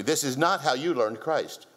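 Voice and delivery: ordinary delivery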